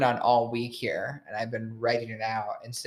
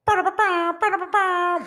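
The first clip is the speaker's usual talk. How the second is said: high sung jingle sound effect; soundboard jingle; 4 dB louder than usual. Pretend. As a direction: mouth a cheesy radio sting, up high.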